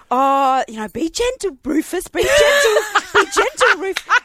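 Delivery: high, loud sing-song cautioning; fussing dog mum. Retold or imitated. Shepherd's mum warns Rufus to be gentle, fussing. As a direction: repeat it as cooing reassurance, hammed up.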